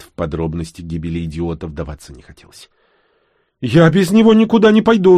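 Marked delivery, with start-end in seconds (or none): 3.6-5.2 high, loud refusal; insistent high-pitched protest; stubborn woman. A narrator's reading. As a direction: dig in your heels, voice raised high. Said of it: "заупрямилась женщина".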